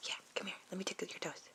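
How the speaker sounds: soft playful whisper. Pretend. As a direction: hush it like a secret before tickling. Parent whispers before tickling baby.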